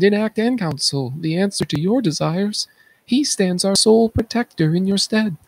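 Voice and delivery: high-pitched urgent announcement; high urgent declaration. Acted. Chorus announces Creon.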